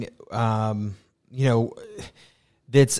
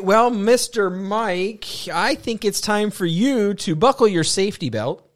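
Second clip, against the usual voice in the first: theme park ride spiel; 7 semitones higher than usual. Pretend. Sends cohost off like a ride.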